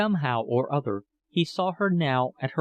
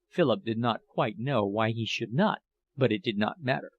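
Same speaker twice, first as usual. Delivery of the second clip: plain reading voice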